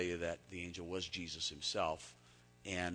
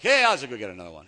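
casual guy; 2 semitones higher, 17 dB louder, faster than usual. Retold or imitated. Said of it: "guys are like".

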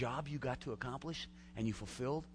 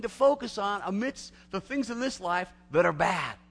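rising excitement; 11 semitones higher, 8 dB louder than usual. Real, not acted. Preacher building excitement about heaven.

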